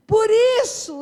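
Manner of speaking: loud, high preaching cry; preaching burst. Real, not acted. Preacher drives home the resurrection with a loud high cry. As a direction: hit it hard from the pulpit.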